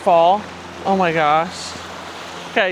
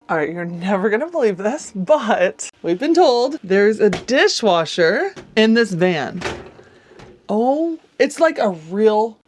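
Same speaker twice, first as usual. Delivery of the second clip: usual speaking voice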